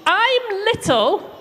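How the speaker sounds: high, loud shout up to a giant; David shouting up at Goliath, high and loud. Acted. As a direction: call up to the giant with all your voice. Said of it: "David shouted up to him".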